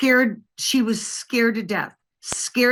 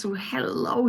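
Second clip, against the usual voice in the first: breathy whispered hello; 5 dB quieter than usual. Retold or imitated. Old woman whispering hello on the phone. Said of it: "she says".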